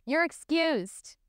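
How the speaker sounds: lofty, high, slow dismissal; dismissive. Retold or imitated. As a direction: wave him off haughtily. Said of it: "she just dismissed future president".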